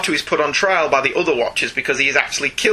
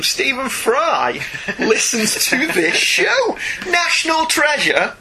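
laughing in disbelief; 9 semitones higher, much slower than usual. Real, not acted. Thrilled by a famous listener.

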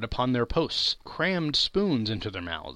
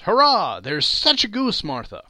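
put-on high, loud children's cheer; 6 semitones higher, 7 dB louder than usual. A narrator's reading. Young Cratchits cheering to Martha, loud high cheer. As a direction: shout for joy over the goose.

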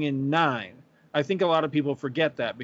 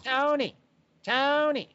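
high mock calling Tony; imitating Rhodey calling; 11 semitones higher, slower than usual. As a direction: call the name out high and thin. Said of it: "his voice just grates on me like nails on a chalkboard".

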